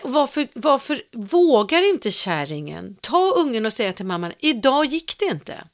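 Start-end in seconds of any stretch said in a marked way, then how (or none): 4.4-5.6 higher, louder, slowed and emphatic; pretend firm telling-off. Friend imagining the grandmother scolding the mother, firm.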